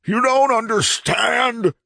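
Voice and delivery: very high, slow, drawn-out cry; anguished wail. A narrator's reading. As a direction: wail it out in desperation. Madman desperate to be understood.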